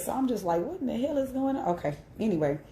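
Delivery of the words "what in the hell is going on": surprised. Retold or imitated. Reacting to funeral chaos.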